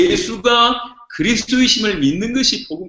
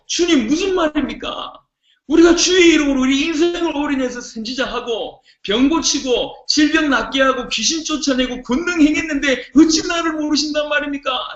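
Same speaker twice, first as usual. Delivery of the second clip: higher, indignant demand to the Lord; indignant arguing with God; 6 semitones higher than usual. Retold or imitated. Believer protesting to the Lord, indignant and higher. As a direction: argue your case, offended and higher. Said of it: "라고 따지는 얘기입니다".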